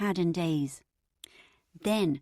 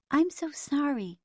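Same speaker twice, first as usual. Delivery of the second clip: gentle nasal voice, slow and higher; 8 semitones higher, slower than usual. A narrator's reading. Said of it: "interrupted a gentle nasal voice".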